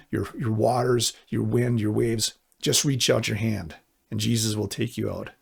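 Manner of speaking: ordinary talk, nothing marked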